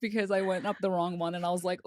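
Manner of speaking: usual speaking voice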